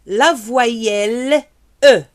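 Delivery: slow, careful teacher model of "La voyelle E"; model vowel E. Shown as a demonstration. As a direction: say "La voyelle E" slowly, vowel clearly open. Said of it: "Répétez".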